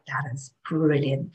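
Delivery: soft, slow, hushed marvel; hushed awe. Retold or imitated. To herself at the crossroads, a hushed awestruck murmur. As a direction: breathe it out in quiet wonder.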